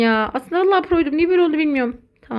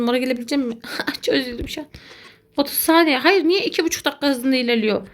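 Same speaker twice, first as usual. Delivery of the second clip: normal talking voice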